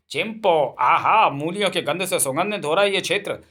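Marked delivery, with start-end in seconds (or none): none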